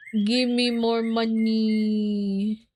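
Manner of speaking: slow, drawn-out money demand; slow greedy drawl. Pretend. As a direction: stretch every word, begging for money.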